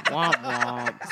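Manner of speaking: womp womp through laughter; laughing womp womp. Pretend. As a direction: laugh while saying womp womp. Laughing sad trombone again.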